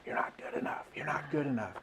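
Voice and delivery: insidious hushed whisper of self-doubt; soft, voiceless inner-critic whisper; whispering in the ear. Acted. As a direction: whisper it like a nagging voice on the shoulder. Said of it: "just whispering in our ear saying".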